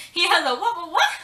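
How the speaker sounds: high, loud, repeating in disbelief; shocked loud disbelief. Retold or imitated.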